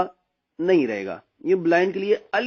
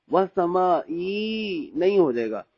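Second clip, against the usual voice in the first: ordinary delivery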